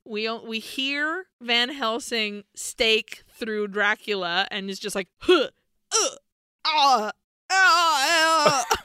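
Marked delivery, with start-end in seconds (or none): 5.2-8.8 mock death cries, loud and higher; dying gasps. Dracula gasping as the stake goes in. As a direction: ham up a vampire's last gasps.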